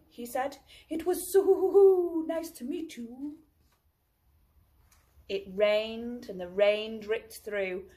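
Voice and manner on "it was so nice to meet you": higher, slow, fond owl; a fond goodbye in the owl's voice; owl farewell. Acted. Owl saying goodbye to Baby Bear, slow and higher.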